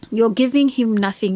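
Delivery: normal talking voice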